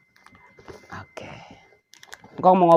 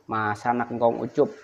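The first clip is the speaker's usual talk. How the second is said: normal talking voice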